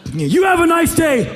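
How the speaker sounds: high, loud sarcastic farewell; loud mock pleasantry. Wishing the boy a nice day, loud and high. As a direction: bellow the nicety.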